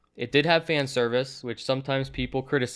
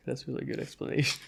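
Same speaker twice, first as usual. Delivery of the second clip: normal talking voice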